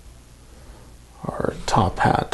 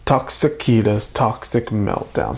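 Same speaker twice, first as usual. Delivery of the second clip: tongue twister taken slower, word by word; slow and careful. Shown as a demonstration. Host retrying the tongue-twister set name for viewers, slowly and carefully. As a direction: say "Toxicita's Toxic Meltdown" slowly and deliberately. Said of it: "have to say it a lot slower".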